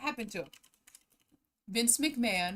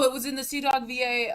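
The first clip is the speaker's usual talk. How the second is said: normal talking voice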